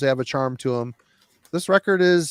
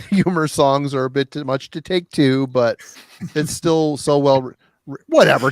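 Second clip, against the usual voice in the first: usual speaking voice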